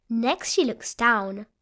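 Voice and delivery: plain reading voice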